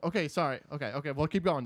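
usual speaking voice

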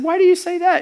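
put-on a dad soothing his crying girl; tender question. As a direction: coax it out gently. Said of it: "And I said".

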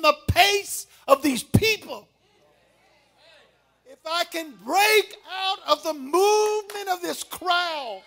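loud, high, slow, crying preacher's climax; full-force preaching. Acted. Preacher voicing the leper pushing through the crowd, loud.

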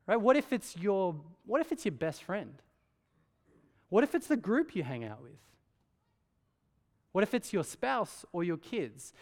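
ordinary delivery

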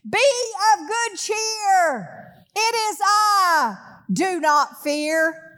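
high, slow, gentle voice of Jesus; lifted, drawn-out reassuring call; Jesus speaking. Acted. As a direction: soothe frightened disciples from the storm. Jesus voiced calming the disciples.

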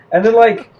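ordinary talk, nothing marked

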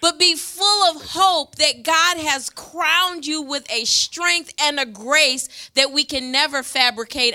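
rally the men from the pulpit; preaching at full force. Acted. Pastor to the men of the church, rousing preaching.

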